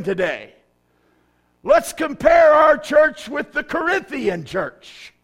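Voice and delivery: high, loud, slow preaching; full-force preaching. Acted. Pastor rousing the church, loud slow preaching. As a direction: build it up and shout to the congregation.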